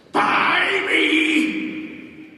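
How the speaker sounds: high, loud creature squawk; creature shout. Acted. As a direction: shriek it like a monster. Kangaroo shouts in story.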